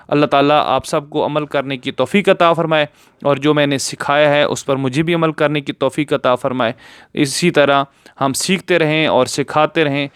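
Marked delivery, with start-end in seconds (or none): none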